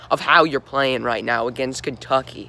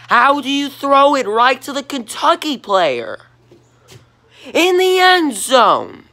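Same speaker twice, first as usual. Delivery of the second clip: outraged; 9 semitones higher, 5 dB louder than usual. Real, not acted. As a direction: yell it at the quarterback through the screen. Yelling at quarterback's bad throw.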